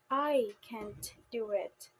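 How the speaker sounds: long, drawn-out I at the start; slow, weighted I before "can't do it"; stress on I. Shown as a demonstration. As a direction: lean slowly on the word I. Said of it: "on a particular word in a sentence".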